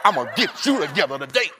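loud mock threat; texting with attitude. Texter pressing buttons to send attitude, mock angry threat. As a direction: punch it out as a hammed-up warning. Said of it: "tried to send an attitude through a text".

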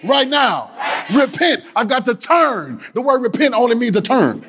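high-pitched, stretched preaching cry; full-force preaching. Acted. Preacher calling congregation to repent and turn, full-force preaching. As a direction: proclaim it high, drawing out the key phrases.

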